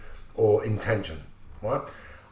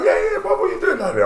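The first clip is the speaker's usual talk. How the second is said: higher, laughing delighted greeting; 4 dB louder than usual. Retold or imitated. Big Nasty asking Marvin why he is there, laughing. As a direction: greet an old friend with a laugh.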